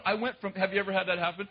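ordinary talk, nothing marked

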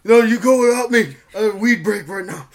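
loud stoner brush-off; Seth Rogen. Acted. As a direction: wave a friend on in a stoner voice. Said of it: "Can you do Seth Rogen?".

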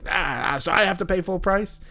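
put-upon complaint at being made to pay; George Costanza. Retold or imitated. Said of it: "I felt like George Costanza".

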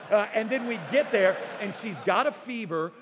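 ordinary talk

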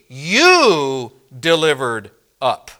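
"You" drawn out and punched, slow and deliberate; stress on you. Shown as a demonstration. Preacher accusing the listeners, stressing "You" slowly. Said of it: "it's emphatic".

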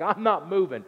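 high, loud defiant refusal; mock sit-in protest; stubborn Moses. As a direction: Moses digging in his heels.

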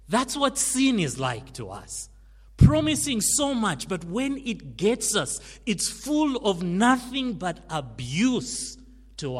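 loud, high-pitched preacher's cry, slowing at the end; preaching at full force. Acted. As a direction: hammer it home from the pulpit. Preaching climax on sin.

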